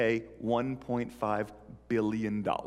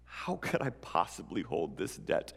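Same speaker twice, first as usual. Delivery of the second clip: quiet sincerity; 6 dB quieter than usual. Acted. Friend explains his forgiveness.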